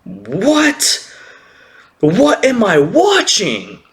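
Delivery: high, stretched-out astonishment; jaw-dropped disbelief. Retold or imitated. Viewer watching on the phone, jaw-dropped disbelief. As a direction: gape at it, pitch soaring. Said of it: "my jaw dropped".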